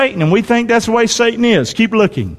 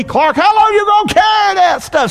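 God calling him out; 10 semitones higher than usual. Retold or imitated. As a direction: imitate God calling out to Clark. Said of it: "God's working on me".

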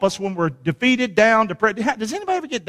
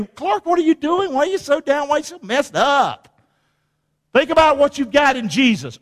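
high-pitched questioning of himself; self-scolding; 6 semitones higher than usual. Acted. Preacher asking himself why he's down, high-pitched scolding. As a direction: pitch it up, taking yourself to task.

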